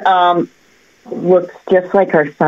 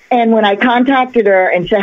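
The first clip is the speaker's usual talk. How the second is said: ordinary talk, nothing marked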